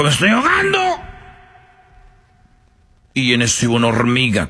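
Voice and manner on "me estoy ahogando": high pleading donkey voice; high panicked plea; donkey's cry. Acted. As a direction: squeal it like a drowning donkey. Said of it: "Y el burro dijo".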